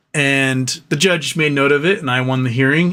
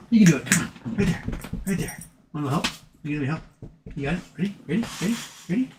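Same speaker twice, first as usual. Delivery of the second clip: coaxing pet talk; 4 semitones higher than usual. Real, not acted. Talking to his dog.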